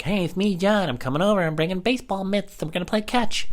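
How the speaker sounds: put-on high, squeaky twelve-year-old boy; kid voice John. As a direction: play a pesky little kid on the phone. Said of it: "call up as this guy, John".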